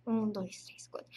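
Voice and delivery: whispering the count under the breath; whispered stroke count. Shown as a demonstration.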